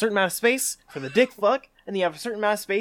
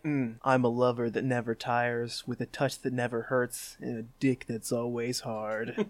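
lower, soft sultry voice; mock Dickens lover; 6 semitones lower, 9 dB quieter than usual. Host voicing Dickens sexting, low and soft. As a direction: purr it low like a seducer. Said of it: "he'd be more like".

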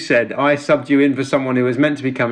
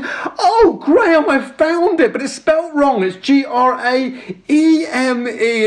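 posh lady; 9 semitones higher, slower than usual. Retold or imitated.

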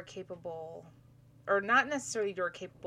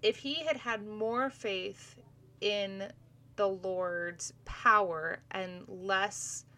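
normal talking voice

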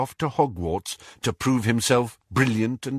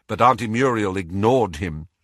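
usual speaking voice

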